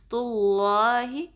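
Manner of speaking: اللہ with a full, heavy, velarized lam, drawn out slowly; heavy lam in Allah. Shown as a demonstration. Tajweed teacher demonstrating a heavy lam to students. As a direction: raise the back of the tongue on اللہ. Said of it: "تو لا نہیں بلکہ تو اللہ".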